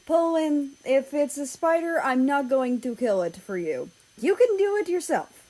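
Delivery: Germany calling back while signing papers. Acted. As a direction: call back without looking up. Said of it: "He called back".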